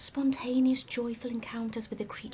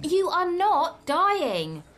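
loud, slow, emphatic rebuke; raised, drawn-out scolding; exasperated insistence; 7 semitones higher, 9 dB louder, much slower than usual. Acted. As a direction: hit every word hard and slow. Exasperated at his melodrama.

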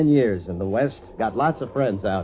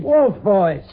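excited greeting; 8 semitones higher, 5 dB louder than usual. Acted. Greets an old friend, calling out.

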